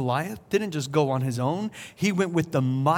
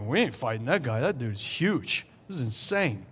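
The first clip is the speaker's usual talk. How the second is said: hushed, softer mock-scared mutter; scared soldiers; 6 semitones lower, 8 dB quieter than usual. Israelite soldiers refusing Goliath, hushed and mock-scared. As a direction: play the frightened soldiers backing off. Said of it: "They were like".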